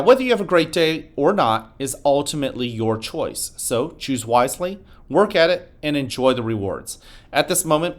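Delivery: announcer. Acted. Morning announcement to school.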